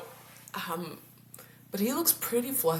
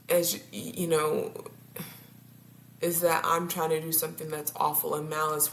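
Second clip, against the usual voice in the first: ordinary talk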